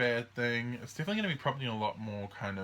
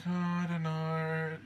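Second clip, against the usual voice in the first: higher, slow, drawn-out uncertainty; mock dithering; 7 semitones higher, 3 dB louder, slower than usual. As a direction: dither lazily with no clue. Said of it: "They're like".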